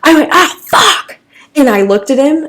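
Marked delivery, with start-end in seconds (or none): 0.3-1.2 high, sharp yelp of pain. Retold or imitated. Retelling the moment the doctor hit a nerve, sharp pained cry.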